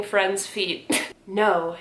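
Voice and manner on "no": normal talking voice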